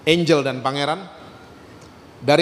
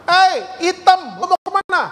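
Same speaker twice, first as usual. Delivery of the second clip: high, raised taunting call; mock shout at a classmate; imitated schoolkid's yell; 12 semitones higher, 4 dB louder than usual. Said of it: "Temennya dari gerbang sekolah teriak, kencang banget".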